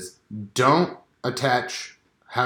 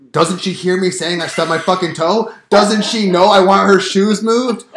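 frustrated complaint; 6 semitones higher, 6 dB louder than usual. Pretend. Husband venting about shoes he keeps tripping on, exasperated. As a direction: vent the pent-up grievance.

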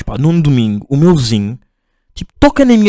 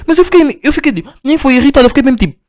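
high baffled squeak; 11 semitones higher than usual. Real, not acted. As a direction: sound utterly dumbfounded. Baffled reaction. (espanto agudo)

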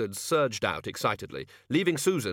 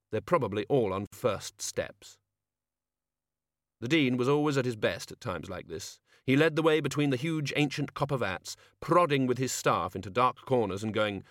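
plain reading voice

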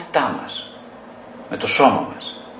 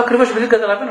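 higher, louder on ακριβώς; emphatic retort; 9 semitones higher, 5 dB louder, much faster than usual. Retold or imitated. Therapist answering a demanding client, pointed emphatic retort. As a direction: punch ακριβώς up and loud.

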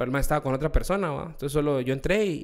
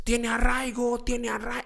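mock crowd yell, higher pitched; retold shouting; 9 semitones higher, slower than usual. Border touts heckling him as he walks, mock yelling. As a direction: chant it like the border guys heckling. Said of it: "empiezan a gritar los cerotes".

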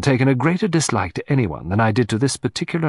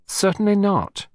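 put-on affected young man; 9 semitones higher, 4 dB louder than usual. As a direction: deny it loftily in a raised voice. Affected young suspect denies it.

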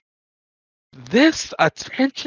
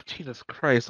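weary sigh; 9 semitones lower, 9 dB quieter than usual. Retold or imitated.

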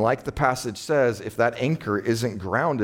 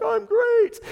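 mock falsetto of a weepy "I'm great"; crying person faking fine; 4 dB louder than usual. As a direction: squeak it out in a high fake-cheery voice. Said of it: "and they're like".

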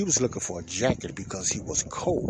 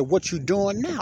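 high, louder sarcastic question; retold sarcastic comeback, pitched up; 10 semitones higher, 5 dB louder, slower than usual. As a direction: throw the question back sarcastically. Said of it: "I said it in a sarcastic way".

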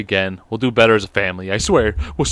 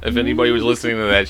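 normal talking voice

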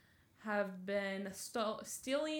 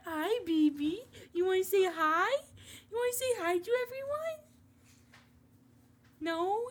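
much higher sing-song puppy talk; 8 semitones higher, 6 dB louder than usual. Real, not acted. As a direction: coo at the puppy, high and sweet. Greets her puppy.

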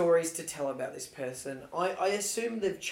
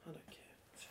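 whispered; 26 dB quieter, slower than usual. Pretend. Dismissive whisper.

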